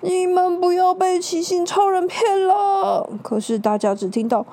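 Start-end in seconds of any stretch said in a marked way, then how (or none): 0.0-3.1 strained, tearful plea; straining. Acted. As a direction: force it out with the last strength. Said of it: "用尽全身的力气说".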